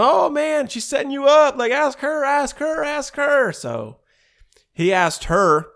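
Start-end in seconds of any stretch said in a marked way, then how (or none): 0.0-3.5 high, excited whooping; mock-excited friends goading him on; egging him on. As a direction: hype your buddy up from the background in a much higher voice. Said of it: "Justin are in the background going".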